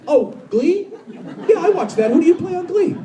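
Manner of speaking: high-pitched imitation of a curious stranger; mock-eager questioning, high; clueless fan. Imitates clueless stranger asking about his show.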